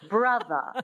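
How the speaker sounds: Brother ending in schwa, transatlantic; non-rhotic transatlantic Brother; transatlantic accent. Shown as a demonstration. Coach models for learner, transatlantic accent. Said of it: "you drop the R".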